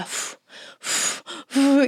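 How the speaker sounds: short puffed-out breaths; imitated breathing. Crying daughter following mom's breathing advice, puffed exhales. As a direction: blow out like a child calming down. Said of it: "mientras ella lloraba, y ella solo hacía".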